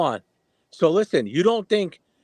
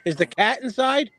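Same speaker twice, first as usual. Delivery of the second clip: calling out; 6 semitones higher than usual. Real, not acted. Calling to Michelle about cat.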